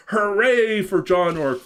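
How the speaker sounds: high, loud cheering shout; crowd cheer. Acted. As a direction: cheer him like the whole village. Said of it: "they all shouted".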